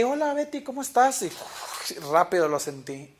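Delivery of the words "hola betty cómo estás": high bright hello; higher, louder mock friendly hello; cheery greeting. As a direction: greet her sweetly, a bit overdone.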